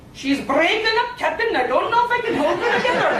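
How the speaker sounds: strained, rising engineer's alarm; ethereal starship engineer. Acted. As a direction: report disaster in a hammed-up engineer voice. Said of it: "an ethereal voice that said".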